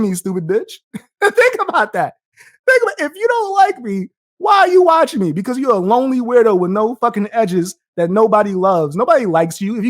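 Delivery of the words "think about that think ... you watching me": taunting. Real, not acted. Taunting a viewer.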